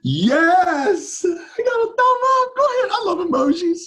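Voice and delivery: very high, squealing celebration; giddy, high-pitched delight; excited cheering. Real, not acted. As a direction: celebrate a tiny win with a soaring squeal. Celebrates getting a thumbs-up.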